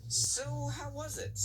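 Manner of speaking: high, eager; eager question. A narrator's reading. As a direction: ask the tired friend eagerly.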